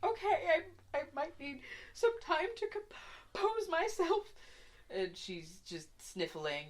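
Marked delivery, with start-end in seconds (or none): 0.0-4.2 put-on high, teary old woman voice; a grieving old woman, holding back sobs; tearful old woman. Weeping old woman Winifred.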